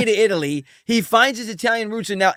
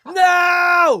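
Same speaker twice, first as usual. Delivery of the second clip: shout; 10 semitones higher, 5 dB louder than usual. Real, not acted. Shouting refusal at casting news.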